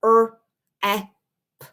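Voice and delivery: "er, e, p" as three separate letter sounds; segmented "er, e, p", a pause after each sound; syllable sounded out. Shown as a demonstration. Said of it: "The first syllable is rep".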